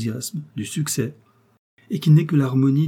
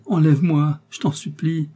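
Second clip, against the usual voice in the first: pleading cry; 6 semitones higher, 4 dB louder than usual. A narrator's reading. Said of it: "s'écria-t-elle".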